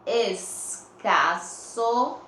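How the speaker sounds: "Escasso" very slow, hissed S, rising end; S sound shown. Shown as a demonstration. Teacher checking the S sound with pupils, slow and broken up. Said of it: "O S tá com o som do S".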